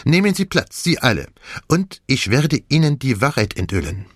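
put-on commanding French-accented detective, high opening; imperious. A narrator's reading. Poirot seats everyone for the truth, imperious. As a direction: take charge of the room. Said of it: "Er wirkte derart gebieterisch".

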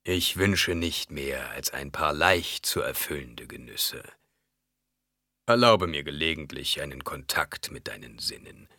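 slow, low, insinuating voice dropping to whispers; coaxing baron. Acted. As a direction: wheedle slowly and low, softening at phrase ends. (einschmeichelnder Baron, tief und langsam)

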